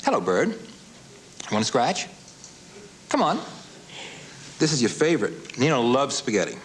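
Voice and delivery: high sweet coaxing voice; coaxing a wild bird. Real, not acted. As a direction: coax the bird gently. Coaxing parrot to perform.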